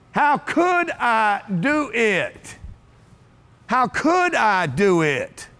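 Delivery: very slow, stretched on every word; slow dictation. Shown as a demonstration. Speaker giving the audience a line to write down, slow and deliberate. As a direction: spell it out slowly for people writing it down. Dictating the phrase for note-takers.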